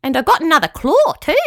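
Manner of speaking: high, loud little boast; squeaky, pleased-with-itself claw creature; proud squeaky creature. A narrator's reading. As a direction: call it out proudly in a tiny critter voice. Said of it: "called the voice proudly".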